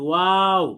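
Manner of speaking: ওয়াও slow, lips rounded; rounded waw demo. Shown as a demonstration. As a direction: show how ওয়াও is read with a round mouth. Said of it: "পড়তে হয়".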